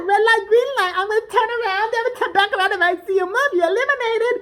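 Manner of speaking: chirpy high robot-doll announcer; creepy doll voice. Retold or imitated. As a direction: play the giant killer doll calling the rules. The killer doll game announcement.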